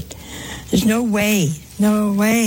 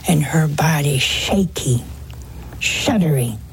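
whispered; 5 semitones lower than usual. Acted. Hushed description.

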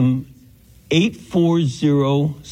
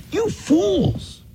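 mock crying out. Teacher acts out Paul calling to the Galatians, mock outcry. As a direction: cry it out in a much higher voice, volume held back. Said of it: "as if he's crying this out".

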